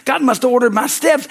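ordinary talk, nothing marked

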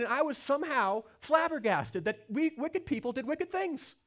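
full-force sermon climb; impassioned preaching. Acted. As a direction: preach it with rising passion from the pulpit. Preacher building to impassioned peak.

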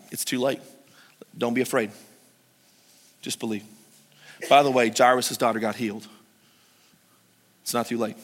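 low, soft, gentle; soft reassurance. Acted. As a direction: comfort the hurting listener quietly.

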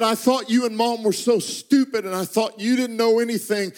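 son's tearful confession at the table. Retold or imitated. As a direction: let the tears rise in the voice. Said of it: "through tears".

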